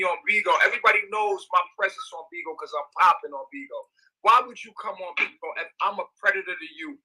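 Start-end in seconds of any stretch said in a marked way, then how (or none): none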